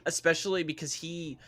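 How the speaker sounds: ordinary talk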